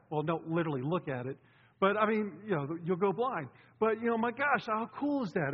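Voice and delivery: ordinary talk, nothing marked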